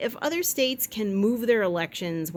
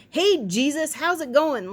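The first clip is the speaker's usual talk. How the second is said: caller greeting; 6 semitones higher, 5 dB louder than usual. Retold or imitated. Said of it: "I love it when people go".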